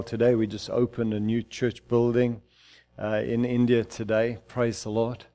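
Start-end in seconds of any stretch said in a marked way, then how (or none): none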